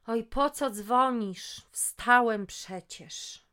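put-on slow, drawn-out grumble of a half-asleep teen; groggy and annoyed, stretched out slowly; sleepy voice. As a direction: son on the phone, woken by his mother, slow and sleepy. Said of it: "Rafał mówił zaspanym głosem".

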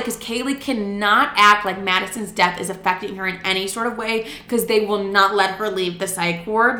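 usual speaking voice

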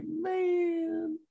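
a high, soft, whimpering "Man"; mock sad cry. Fan mourning two favourite characters dying, high soft whimper. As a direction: whine it like a fan mourning his favourite dudes. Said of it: "Those two make me real sad".

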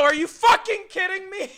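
hammed-up angry yell in the car; mock outrage. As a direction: blurt it in disbelief. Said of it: "And I go".

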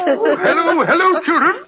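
hearty Santa greeting. Acted. Doctor in Santa costume greeting the children, jolly and laughing. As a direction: beam at the kids like a laughing Santa.

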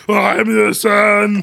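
slow, high, drawn-out monster voice; hammed-up monster son. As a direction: drag it out like a lumbering creature claiming its son.

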